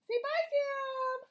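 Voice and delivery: high squeaky little-friend voice; squeaky play voice. Pretend. A mother playing a toy saying goodbye, high and squeaky. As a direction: chirp a goodbye in a tiny voice.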